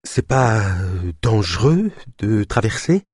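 slow, broken by whistling exhales; wheezy hissing breaths. Acted. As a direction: gasp out the question between wheezes. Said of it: "d'une voix entrecoupée d'expirations sifflantes".

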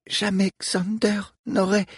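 strangled, the words catching. Acted. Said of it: "d'une voix étranglée".

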